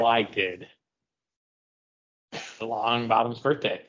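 ordinary talk

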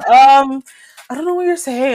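high, prissy mock classmate voice.

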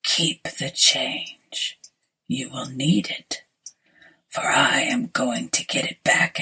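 whispered, low sinister stranger; low looming threat. Acted. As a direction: breathe it like a pale ghost. Pale stranger whispers his lines.

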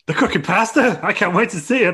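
high, loud, hammed-up excitement; excited commentator. Commentator thrilled to see cooking pasta, excited. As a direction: gush like a hyped-up announcer.

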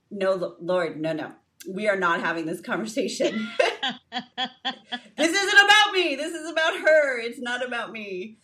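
high, loud laughing protest, half joking. Retold or imitated. Mother laughing as she protests to the Lord, high and loud. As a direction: laugh it off while you push back.

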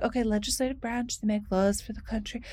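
soft, restrained teacher explaining; mild-mannered classroom delivery; nice teacher voice. Shown as a demonstration. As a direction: keep it nice and quiet, like a polite teacher. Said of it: "say it in a nice teacher voice".

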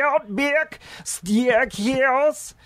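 put-on high, slow Aussie accent; a hammy Australian voice; bad Australian accent. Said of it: "my Australian accent".

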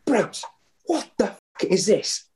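high, mock furious manager voice; angry manager. Footballer retelling furious manager down the phone. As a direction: play the boss swearing down the phone.

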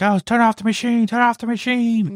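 high-pitched mock panic cry; imitated panic. Scientists facing a rampaging creature, imitated high-pitched panic. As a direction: imitate frantic scientists crying out, voice way up.

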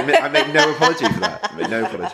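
amused, unrepentant, laughing as you speak. Real, not acted. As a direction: talk through a laugh. Laughing while defending the fun.

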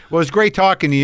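ordinary talk, nothing marked

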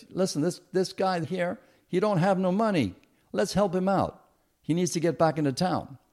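acted-out public appeal, pitched high; appealing to a crowd. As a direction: plead someone's case to the whole bus. Passenger appeals to the whole bus.

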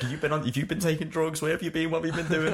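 high-pitched barrage of questions; mock interrogating mum. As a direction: imitate a worked-up mum demanding answers. Mum interrogates child, acted out.

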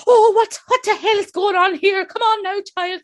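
high, loud Irish scolding; imitating an old Irish nanny breaking it up; old Irish woman. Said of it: "the old Irish woman runs in".

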